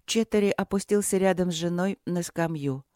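ordinary delivery

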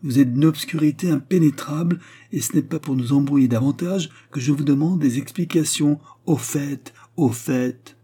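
high, pressing queen's demand; impatient queen. A narrator's reading. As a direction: press him hard, losing patience.

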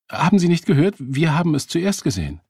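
high, loud, indignant challenge; indignant protest. A narrator's reading. Woman disputing a taxi with a stranger, indignant. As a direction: protest sharply, pitched well up.

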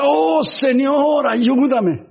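high, very slow plea to God; pleading prayer. Acted. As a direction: beg the Lord for help, drawn out. Acted prayer of a worried man.

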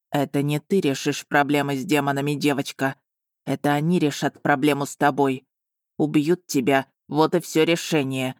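understated stern dragon; dragon's voice. A narrator's reading. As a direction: talk down to her as the old dragon. Dragon threatens the girl.

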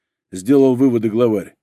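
plain reading voice

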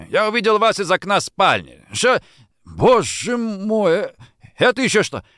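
theatrical shock. A narrator's reading. Man spotting a body in the snow, theatrical horror. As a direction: exclaim it like a stage actor. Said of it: "в несколько театральной манере".

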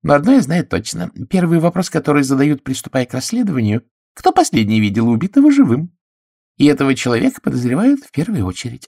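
high, lecturing spinster voice; self-appointed expert. A narrator's reading. Miss Gannett lectures on detection, high and knowing. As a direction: lay it out like an expert.

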